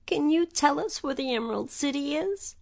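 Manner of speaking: a hint of a worried girl; Dorothy. A narrator's reading. Dorothy voiced.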